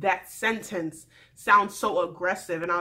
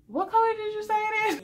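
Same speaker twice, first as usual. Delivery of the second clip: high mock-confused question; 11 semitones higher, faster than usual. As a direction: pitch it up, playing dumb. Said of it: "you said".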